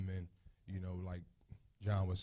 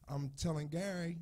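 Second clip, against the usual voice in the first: ordinary talk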